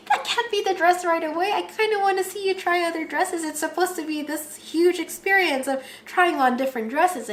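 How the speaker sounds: high-pitched, insistent objection; protesting sister. Retold or imitated. Sister urging the bride to try other dresses, high insistent protest.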